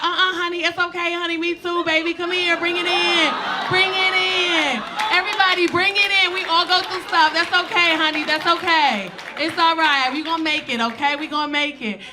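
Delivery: high, loud, comforting diva; loud high comforting honey voice; sassy flight attendant. Retold or imitated. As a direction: gather everyone in like a loving sassy attendant.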